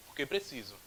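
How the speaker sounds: porque clipped and soft; fast, quiet porque; quick answer. Shown as a demonstration. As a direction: rattle off the answer. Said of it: "um porquê que sai mais rapidinho".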